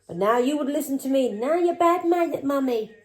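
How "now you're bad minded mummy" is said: higher, slow whiny put-on voice of the daughter; mimicking daughter. Mother mimicking daughter calling her bad-minded, higher whiny mock. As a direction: parody her daughter's complaint.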